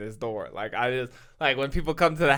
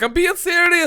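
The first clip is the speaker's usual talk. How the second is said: much higher, louder mock Liverpool insistence; mock earnest fan; 9 dB louder than usual. Mocking the fan's insistence, high mock Liverpool accent. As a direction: squeak out his protest in his accent. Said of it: "he's like".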